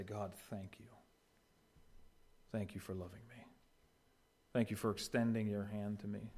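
soft, hushed thanks; grateful prayer. Pretend. A believer thanking God in prayer, soft and hushed. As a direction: pray it quietly from the heart.

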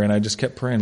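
ordinary talk, nothing marked

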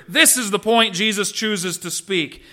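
ordinary talk

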